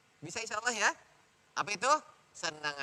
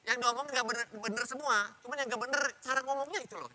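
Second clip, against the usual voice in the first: higher-pitched, lightly acted complaint; complaining child; 7 semitones higher than usual. Child telling others about mother's nagging, complaining. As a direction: air a grievance in a raised pitch. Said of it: "Kata begitu dia ngadu tuh orang-orang".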